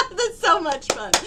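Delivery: bursting into loud laughter; laughing. Real, not acted. Healer laughing with delight while sensing the energy. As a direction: laugh through the words, high and loud.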